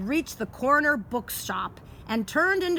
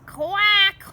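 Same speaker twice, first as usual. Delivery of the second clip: high, loud startled quack; duck quack; 8 semitones higher, 6 dB louder than usual. Acted. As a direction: quack like a frightened mother duck. Mrs Mallard quacks.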